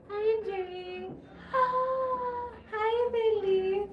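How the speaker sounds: high, drawn-out delighted squeal; thrilled, stretched-out hello; excited greeting. Real, not acted. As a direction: greet them in a loud, slow singsong squeal. Greeting live viewers by name.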